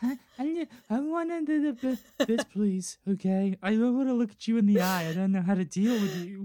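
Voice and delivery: put-on high, flustered childlike pleading; a shy kid avoiding eye contact; regressed to a child. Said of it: "my brain just regresses to a child".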